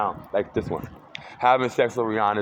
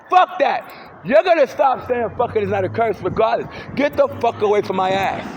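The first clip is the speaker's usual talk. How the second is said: angry shout; 9 semitones higher, 4 dB louder than usual. Real, not acted. Angry outburst.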